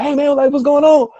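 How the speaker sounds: imitated high-pitched hail; eager friendly greeting. Retells a waving stranger's friendly greeting.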